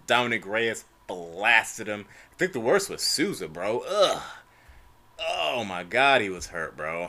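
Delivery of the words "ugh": loud, high wincing "ugh"; disgusted groan. Real, not acted. Fan recalling the worst knockout, pained loud groan. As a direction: recoil from a brutal hit.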